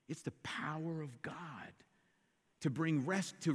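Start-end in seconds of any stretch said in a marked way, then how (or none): 0.1-1.7 hushed, unvoiced power of God; whisper. Preacher whispering the verse's key phrase to the congregation. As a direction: breathe it like a secret.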